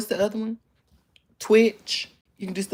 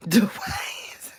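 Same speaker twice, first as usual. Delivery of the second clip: whisper; 4 semitones higher than usual. Real, not acted. Reading a funny username aloud.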